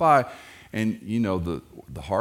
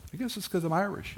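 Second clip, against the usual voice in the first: Red's line; 11 semitones higher, 4 dB quieter, faster than usual. Retold or imitated. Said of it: "he goes".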